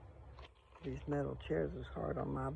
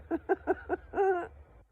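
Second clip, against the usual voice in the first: high giggle; 6 dB louder than usual. Real, not acted. Laughs at her own craving.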